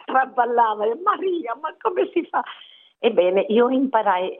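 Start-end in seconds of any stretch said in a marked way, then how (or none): 1.0-2.4 much higher, softer exasperated plea; mock despair over the tipsy cook; exasperated cry. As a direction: throw up your hands in dismay at "ma come si fa". Retold dismayed cry to Maria.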